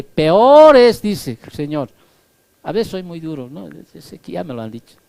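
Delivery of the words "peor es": drawn out and louder; loud, slow warning. Acted. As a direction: thunder it slowly from the pulpit. Preacher warning of hell. (advertencia fuerte y lenta)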